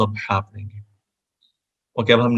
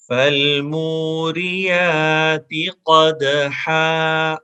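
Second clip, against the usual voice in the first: slow melodic chant, a bit higher; Quranic recitation; 4 semitones higher, 4 dB louder, much slower than usual. Shown as a demonstration. Teacher chanting the verse for students to repeat. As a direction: recite each verse slowly for repetition. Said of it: "آپ مجھے سنیں اور ... repeat کریں".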